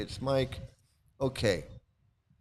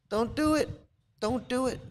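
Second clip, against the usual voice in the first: high pleading warning; 11 semitones higher than usual. Shown as a demonstration. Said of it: "warning repeated high".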